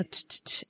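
bare "t" sounds tapped out; sharp isolated "t" with no vowel; crisp T sound. Shown as a demonstration. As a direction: tick out "t, t, t" as consonants alone. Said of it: "there's no T".

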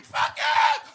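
high, loud outburst of rage; angry scream. Acted. Comic venting about the joy of anger, screaming. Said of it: "being pissed off and just screaming".

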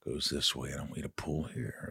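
imitating Piper's quiet how-to; low hushed instructing wrestler. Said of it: "he goes".

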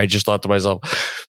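ordinary talk, nothing marked

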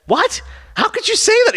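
very high mock squeal; scandalized disbelief. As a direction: play up the shocked indignation in falsetto. Retold offended reply to advances.

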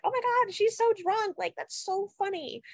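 very high, gushy imitation; mock middle-schooler. Imitating amused middle-school reaction to drunks.

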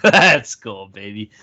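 rally behind the pick; hyped cheer. Real, not acted. Backing the Bruins bet to the boys, a loud pumped-up cheer.

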